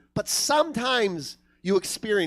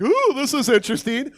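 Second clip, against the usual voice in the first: higher, louder intrigued "ooh"; mock intrigue; 6 semitones higher, 5 dB louder than usual. Comic reacting to a murder documentary, mock intrigued. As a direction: act out piqued interest.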